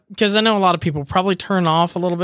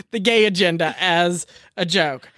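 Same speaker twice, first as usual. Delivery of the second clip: normal talking voice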